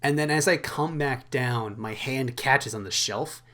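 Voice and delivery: ordinary talk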